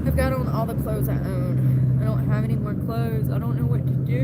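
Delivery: ordinary talk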